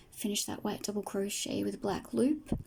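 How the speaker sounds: ordinary delivery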